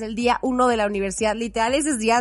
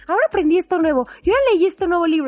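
fast, higher and louder, bubbling with news; imitating eager Dani; 6 semitones higher, 5 dB louder, much faster than usual. Imitating her friend's excited chatter, fast, higher and louder. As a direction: rattle it off like a friend thrilled by a new book. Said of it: "Es como".

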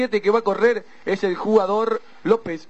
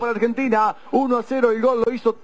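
stretched goal call, louder; breakneck goal commentary; 6 semitones higher, 5 dB louder than usual. Real, not acted. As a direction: call the goal at full roar. Goal scored in match commentary.

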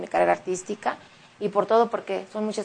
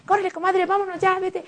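hurrying friend; 10 semitones higher than usual. Retold or imitated. Friends hurrying her to leave.

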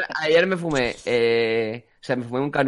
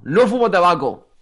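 louder, slower, drawn-out denial; emphatic insistence; 4 semitones higher, 6 dB louder, slower than usual. Real, not acted. Guest insisting to friends about smoking, loud emphatic denial. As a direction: raise pitch and hammer it home.

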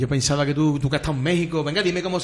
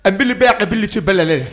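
playful gibberish passed off as Swahili; fake Swahili gibberish; 3 semitones higher, 6 dB louder than usual. Host jokingly answers in fake Swahili, playful gibberish. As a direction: babble nonsense words as if in Swahili. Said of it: "en suajili".

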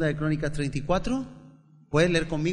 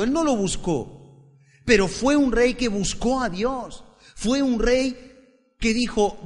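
preaching at full force; 8 semitones higher, 6 dB louder than usual. Acted. Pastor preaching to the congregation about young King Josiah, high and loud. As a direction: proclaim it to the church with full force.

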